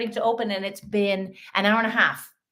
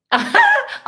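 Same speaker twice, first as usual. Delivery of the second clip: high squeal of laughter; 10 dB louder than usual. Real, not acted.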